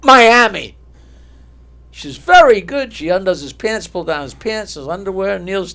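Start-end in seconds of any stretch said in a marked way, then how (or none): 0.0-0.8 loud, higher one-word burst; triumphant blurt. Acted. Stutterer blurts city without stuttering. 2.2-2.9 loud, high acted approval; approving exclamation. Woman approves the winning answer.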